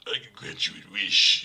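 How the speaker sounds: loud, a hint of the creepy genie; Wishmaster voice. Imitating the original Wishmaster actor granting a wish, loud. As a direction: put on the genie's menacing offer. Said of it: "his voice goes, like".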